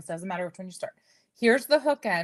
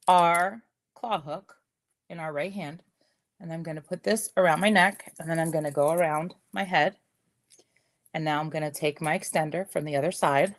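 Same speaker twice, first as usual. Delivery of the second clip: usual speaking voice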